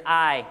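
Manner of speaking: "I" alone, punched out loud; stress on I. Shown as a demonstration. Preacher singling out the builder, emphatic "I". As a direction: land hard on a single "I". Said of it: "That first phrase".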